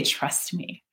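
very soft, low, near whisper; hushed reassurance. Retold or imitated. God answers a worried wife in a hush. Voicing God's quiet answer.

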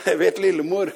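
high, real burst of laughter; laughing. Real, not acted. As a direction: laugh heartily around a brief aside. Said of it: "Jeg ler mer".

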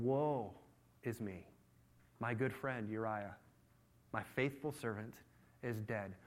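low, soft, halting lament; put-on grief, slow and hushed; mock mourning. David feigning grief over his servant, mock mourning.

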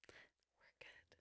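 barely audible whisper. Acted. Cohost confiding they don't want another baby, whispering. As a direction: whisper it under your breath. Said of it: "For those who can't hear her whispering".

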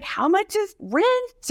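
high, slow, anxious worry; fretting about money; stressed panic. Retold or imitated. New in New York, she frets high and slow. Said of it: "you're like".

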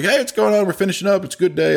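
chirpy upbeat impression of the worker; happy technician. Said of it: "He's like".

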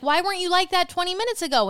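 pitched high, mock exasperated question; exasperated quote. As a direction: toss it at him half-annoyed. Quoting herself to husband.

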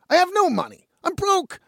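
high-pitched, incredulous denial; retold exasperated self-defence; indignant protest.

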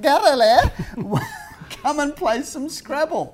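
high, slow Austrian mum calling; Austrian accent. Retold or imitated. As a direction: sing it out like an Austrian mother. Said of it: "in that wonderful Austrian, Austrian accent".

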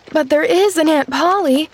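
mildly worried, insisting on an answer; anxious demand. A narrator's reading. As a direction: demand it with a touch of anxiety.

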